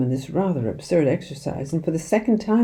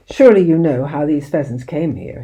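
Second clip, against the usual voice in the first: ordinary delivery, nothing marked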